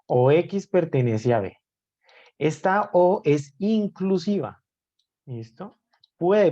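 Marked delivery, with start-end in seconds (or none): 2.4-4.6 O stressed higher and drawn out slowly. Shown as a demonstration. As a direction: lean on the O in "Esta O es inclusiva", said slowly. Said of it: "Hago más énfasis en la O". (énfasis en la O, más aguda y lenta)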